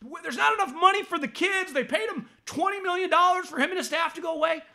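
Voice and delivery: mocking the critics. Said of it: "another narrative I love is like".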